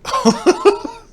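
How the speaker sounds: loud squealing laugh; high burst of laughter. Real, not acted. Laughs at the other streamer.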